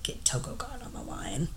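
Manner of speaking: unvoiced, hushed command; whispered order. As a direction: breathe the order out like a scheming boss. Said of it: "He's like".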